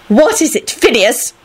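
high, testy question; irritable snap. A narrator's reading. Minerva turning on the interrupting Phineas, irritable. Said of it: "Minerva snapped out irritably".

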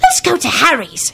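put-on mildly indignant kid voice; indignant little boy. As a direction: argue back like an upset boy. Boy argues with the old man.

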